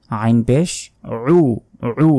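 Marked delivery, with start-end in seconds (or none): 2.1-2.1 "উ" squeezed from deep in the throat; আইনের উচ্চারণ. Shown as a demonstration. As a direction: start "উ" with an Arabic ayn. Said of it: "গলার ভেতর থেকে উচ্চারণ হবে". ("উ" গলার ভেতর থেকে)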